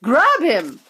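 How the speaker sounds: loud sharp command; ordered. A narrator's reading. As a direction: bark the order. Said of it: "ordered Mister Wonka".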